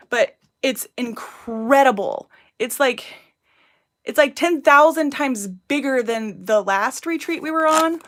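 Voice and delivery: raving about the venue; excited. Real, not acted.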